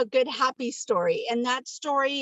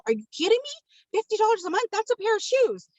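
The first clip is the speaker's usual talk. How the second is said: much higher, incredulous mock outrage; retold disbelief; 10 semitones higher than usual. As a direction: squeal it like the price is a joke. Retold shock at coffee's price.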